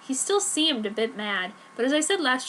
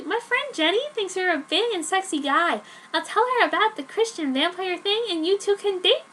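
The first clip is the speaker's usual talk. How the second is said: Joan's voice; 6 semitones higher than usual. Acted. Joan gushing to Dad about her boyfriend, higher. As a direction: gush like a lovestruck teen.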